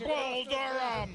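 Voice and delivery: drawn-out, high celebratory nickname; excited. Real, not acted. Colour commentator hails the intercepting player, excited. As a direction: stretch the nickname out high and slow.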